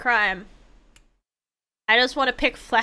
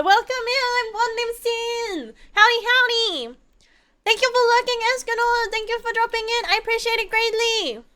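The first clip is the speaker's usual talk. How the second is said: much higher, sing-song host greeting; stream greeting voice; 10 semitones higher than usual. Acted. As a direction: welcome the chat like a cheerful host.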